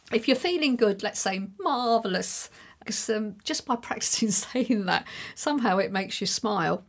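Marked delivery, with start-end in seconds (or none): none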